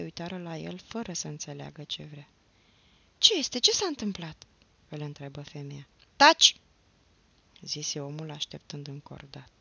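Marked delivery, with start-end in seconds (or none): 3.2-4.5 high, loud startled questioning; woman's startled, raised voice; alarmed question. A narrator's reading. Woman startled by sudden hush. 6.2-6.6 loud, high, sudden command; explosive one-word silencing; sharp hushing order. A narrator's reading. Man urgently silences the woman.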